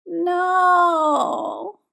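long, high drawn-out whine. A narrator's reading. Husband watching skater take up hockey, whining. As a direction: whine like a dog watching leftovers vanish. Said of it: "in a whine much like the ones".

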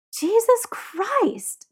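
high, very drawn-out exclamation; exasperated. A narrator's reading. Tyler arguing with Jamie by the grill, exasperated. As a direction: stretch both words in disbelief.

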